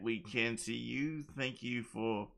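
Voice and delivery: normal talking voice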